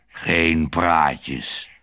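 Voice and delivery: low, gruff threat from the dog Herman; threatening dog. A narrator's reading. Dog Herman warning Otje off, low and threatening. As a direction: growl it as a menacing guard dog. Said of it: "zei Herman dreigend".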